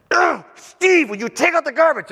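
high, loud exasperated wife with a groan; exasperated wife imitation; annoyed wife. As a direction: nag like a fed-up wife. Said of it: "if she says".

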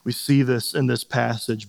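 ordinary talk, nothing marked

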